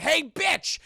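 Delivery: high, loud taunting shout. Acted. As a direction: yell it to get her attention. Tulip calls out to Amelia.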